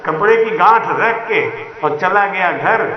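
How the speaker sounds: ordinary delivery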